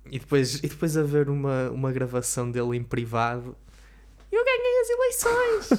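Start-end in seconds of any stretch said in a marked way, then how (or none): 4.3-5.8 high squeaky private Trump voice; shrill mock Trump, much higher; Trump impression. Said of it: "Adorei a tua imitação do Trump".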